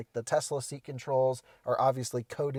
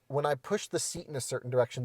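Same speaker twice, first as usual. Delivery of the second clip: ordinary talk, nothing marked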